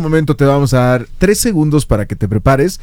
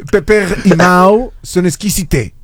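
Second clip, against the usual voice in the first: slow "Pepe y Mau son exquisites" with a Frenchified ending; the host shows the caller how to say it; French-style model line; slower than usual. Shown as a demonstration. Slow French-style model line. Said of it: "dilo así".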